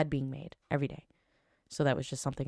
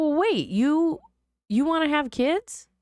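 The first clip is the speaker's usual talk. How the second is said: surprised; 12 semitones higher, 5 dB louder, slower than usual. Retold or imitated. Surprised he wants kids.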